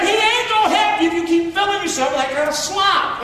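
preacher voicing a stern reply, mildly mocking; scolding elder. Scolding answer voiced in quote.

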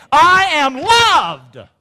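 loud, high, very drawn-out proclamation; emphatic declaration. Shown as a demonstration. Preacher urging everyone to say it again, loud slow proclamation. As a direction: belt out each word slowly for a crowd. Third, biggest repeat for congregation.

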